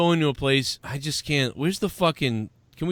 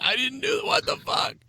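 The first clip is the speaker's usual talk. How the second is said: mock panicked protest; 7 semitones higher, 4 dB quieter than usual. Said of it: "And you're like".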